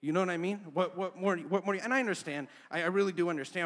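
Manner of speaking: loud, high insistent preaching; preaching at full force. Acted. Pastor driving his point at the church, loud preaching. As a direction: hammer the question home. Preacher rises after quoting himself.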